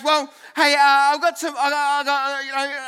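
lightly voiced nervous stalling; hesitant, mildly acted fluster; stammering Mary. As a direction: stumble into breaking awkward news. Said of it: "Mary goes".

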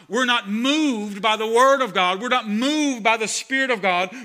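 loud, driving sermon delivery; fiery pulpit preaching, building and pressing; full-force preaching. Acted. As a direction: preach it hard to the congregation.

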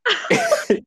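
high-pitched laughing fit. Real, not acted. Bursts out laughing.

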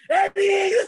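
high, loud mock scream; retold yell. Stanky calling Eric as fire flares, mock panicked yell. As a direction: yell the name out like the prank victim. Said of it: "Se era gritando".

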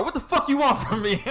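high-pitched mock outrage, half laughing; imitating flipping out. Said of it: "started to flip".

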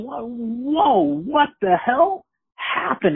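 high, stretched-out disbelief; acted astonishment, high-pitched; retold shock. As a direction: react stunned, pitched well up and slowed. Reacts in shock to the slashing.

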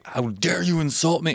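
high, offended huffy voice; indignant outrage. Retold or imitated. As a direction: act deeply insulted. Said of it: "you just fucking make a scene".

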